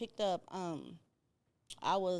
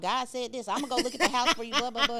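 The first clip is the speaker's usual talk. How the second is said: loud, high mimicry of her pushing; louder, mocking the friend's nagging; 4 semitones higher, 9 dB louder than usual. Guest mimics her friend pushing her to move, loudly. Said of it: "talking about".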